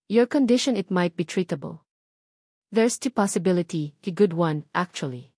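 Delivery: a hint of a measured physician delivering hopeful results; doctor on the phone. Doctor calling about test results.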